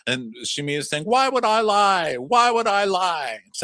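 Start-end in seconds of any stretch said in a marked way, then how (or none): 1.0-3.4 loud, high, slow repeated question; mock pleading. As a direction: hammer it like she's insulted. Voicing Shamea.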